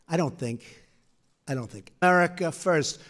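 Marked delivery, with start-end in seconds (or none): 1.5-1.9 much softer, fading to almost nothing; trailing off. Real, not acted. Speaker at the podium, trailing off much softer. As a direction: let the words die away as you finish. Repeated phrase, second fainter.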